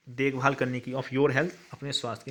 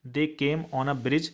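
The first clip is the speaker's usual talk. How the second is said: slow dictation; much slower than usual. Shown as a demonstration. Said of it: "teacher dictates English answer".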